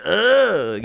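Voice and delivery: high, loud exasperated "ugh"; imitated groan of exasperation. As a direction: groan it out, fed up. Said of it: "It's like that exasperated".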